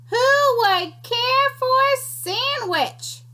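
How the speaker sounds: high, slow sing-song call from the distance; faint far-off call. Acted. Unseen creature calling to the scouts, high and slow. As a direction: lure them in from far away. Voice again, closer and louder.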